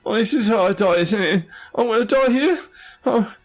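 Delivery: very high, wailing despair; really scared. Acted. Cornered scribe sure he is about to die, high wail. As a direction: whimper that this is the end. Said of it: "He looks really scared".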